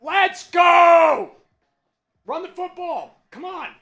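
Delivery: ordinary talk, nothing marked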